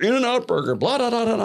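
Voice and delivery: high, gushing mock rave; mock hype. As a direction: ham up the hype crowd. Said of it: "everyone's like".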